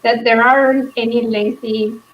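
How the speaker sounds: normal talking voice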